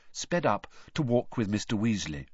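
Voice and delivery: plain reading voice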